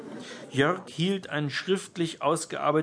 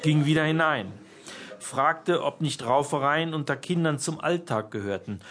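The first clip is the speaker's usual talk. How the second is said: usual speaking voice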